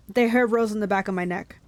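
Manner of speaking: ordinary delivery, nothing marked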